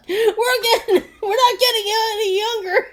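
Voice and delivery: put-on high, laughing impatient old man; laughing mock complaint. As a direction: whine it through laughter.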